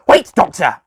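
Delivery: loud, high call after them; yelled. Acted. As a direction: yell as they run off. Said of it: "the master yelled".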